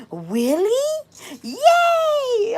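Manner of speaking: high cheering child's squeal, imitated; son's joy at mom's new car, high excited cheer; thrilled child. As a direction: squeal like a delighted four-year-old. Four-year-old thrilled at new car.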